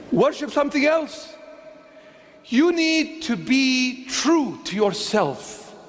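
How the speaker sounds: drawn-out words, pitched high; slow, emphatic urging. Real, not acted. Preacher urging listeners to be true, slow and emphatic. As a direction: stretch each word out slowly for weight.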